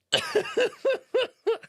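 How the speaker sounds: high, bouncing real giggle; high laugh. Real, not acted. Streamer having fun with hostile comments, high giggle.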